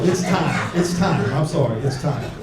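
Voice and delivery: normal talking voice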